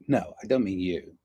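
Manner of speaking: normal talking voice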